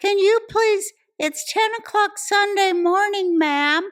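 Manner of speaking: high, slow, insistent mock politeness; complaining lady. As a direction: call loudly through a window, drawn out. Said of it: "Ma'am through the window".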